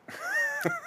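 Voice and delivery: high-pitched burst of laughter. Real, not acted. Laughs at own story.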